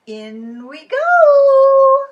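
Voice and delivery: very high, loud and slow; gleeful fox cry. Acted. Fox about to drop Gander in pot, gleeful shout. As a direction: belt it out in villainous glee.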